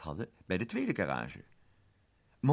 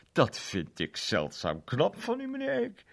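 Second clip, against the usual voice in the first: inspecteur-stem; 10 semitones higher, 5 dB louder than usual. Acted. Inspecteur bewondert Monty.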